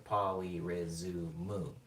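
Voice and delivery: "polyrizumu" drawn out slowly, ending ri-zu-mu; slow Japanese-style "polyrizumu"; five-syllable Japanese pronunciation. Shown as a demonstration. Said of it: "It has five syllables".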